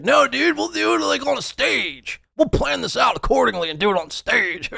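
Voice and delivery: high, mocking scaredy voice; imitating Mersh. S0 mocking Mersh dodging the challenge, high whiny voice. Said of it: "And he's like".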